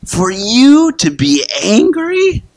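high, slow searching question; God's high, slow question to Jonah; God asking gently. Retold or imitated. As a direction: let each word hang as a patient challenge. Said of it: "God says to Jonah".

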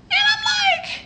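sudden high squeal of disbelief; shocked squeal. Real, not acted. Reacting to the texter's insult with a shocked high squeal. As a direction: squeak out the outrage.

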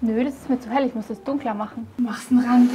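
ordinary talk

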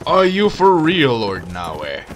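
loud disbelief at the enemy; incredulous shout. Real, not acted. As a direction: call out the weak lord in disbelief. Mocking weak enemy lord.

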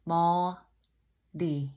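머리 said slowly with a light tongue tap on the 리; slow it down and flick the tongue for 리; slow tapped r. Shown as a demonstration. Teacher demonstrating the tap in 머리 slowly. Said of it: "you need to tap it".

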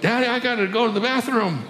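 put-on small child calling for daddy again; little boy. Boy calling from upstairs to his father, high child voice.

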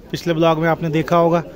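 ordinary talk

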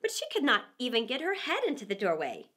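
usual speaking voice

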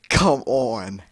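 ordinary talk

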